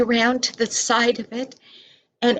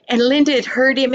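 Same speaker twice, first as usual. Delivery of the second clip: ordinary delivery, nothing marked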